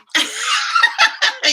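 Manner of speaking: loud high burst of laughter; big laugh. Real, not acted. Host cracking up at the chat, loud laugh.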